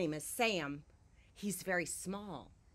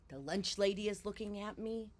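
plain reading voice

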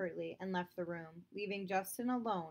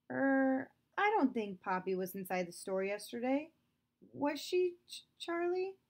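higher, faltering stammer; 6 semitones higher, 4 dB louder than usual. Acted. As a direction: trip over the words nervously. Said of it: "Justin stammered".

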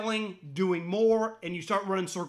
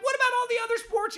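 high, fast mock whine; mocking complainers; 13 semitones higher, faster than usual. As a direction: parody the whiners, quick and high. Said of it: "They're like".

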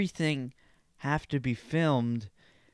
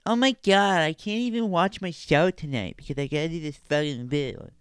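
put-on whiny complainer voice; mock spoiled whiner; 3 semitones higher than usual. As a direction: mimic a spoiled brat's petty complaint. Mock spoiled person complaining about trivia.

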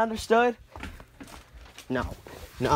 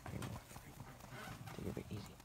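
breathy whispered asides; whispering; 6 semitones lower, 4 dB quieter, much faster than usual. Acted. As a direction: drop to a whisper. Quiet aside after yelling.

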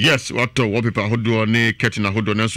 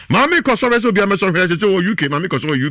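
much higher, louder excited call; excited exclamation; 9 semitones higher, 4 dB louder than usual. Acted. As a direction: burst out in delight. Excited ad skit line.

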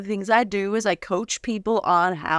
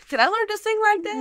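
normal talking voice

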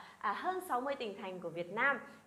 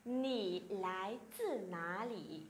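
slow, careful Mandarin question; slow, syllable by syllable Chinese demo; slow model reading; 4 semitones lower, 4 dB quieter, much slower than usual. Shown as a demonstration. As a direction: read it out slowly for students to copy. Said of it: "chúng ta có thể hỏi là".

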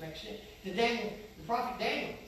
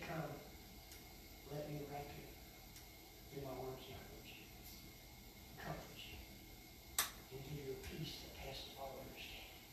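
tender invitation; 6 semitones lower, 13 dB quieter than usual. Acted. Preacher voicing Christ's gentle call to the weary, hushed and tender. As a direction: breathe it out softly like an embrace.

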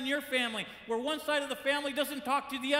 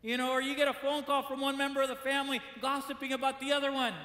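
ordinary delivery

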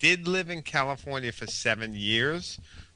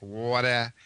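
Long Island "water" as "waw-tuh"; water with a broad Long Island aw; New York accent. Shown as a demonstration. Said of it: "when we say water, the A becomes very".

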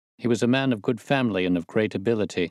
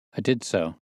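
ordinary delivery

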